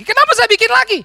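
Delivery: loud, high self-reproach; loud exasperated question; exasperated cry. Real, not acted. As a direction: throw up your hands at yourself.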